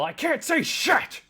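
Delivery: loud, high, drawn-out shout; in-character yell. Acted. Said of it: "I just yell".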